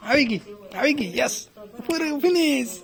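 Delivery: delighted finish cheer. Real, not acted. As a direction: cheer her over the finish line. Cheering child finishing game.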